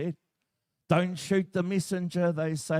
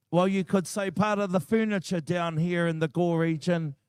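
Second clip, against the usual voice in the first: ordinary delivery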